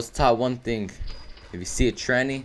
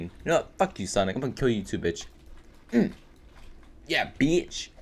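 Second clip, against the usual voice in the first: ordinary talk, nothing marked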